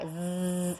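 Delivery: "Z-" as a long soft buzz; isolated Z sound. Shown as a demonstration. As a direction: hold "Z-" as a continuous zzz. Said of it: "a consoante Z tá ali".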